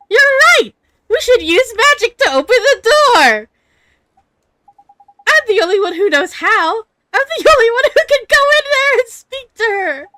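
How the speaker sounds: put-on excited boy hero. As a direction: burst out with boyish determination. Voices the boy hero's resolve.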